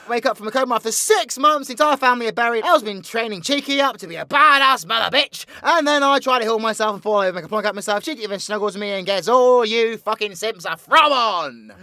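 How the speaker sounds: high, loud, rapid-fire villain storytelling; excitable theatrical villain rattling off a plot; villain's breakneck recap. Acted. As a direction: race through your exploits with showman's gusto. V recaps the series in character.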